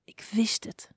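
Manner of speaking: soft, defeated murmur; hushed, resigned aside; mumbled. A narrator's reading. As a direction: mumble it under the breath. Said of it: "mompelde ze".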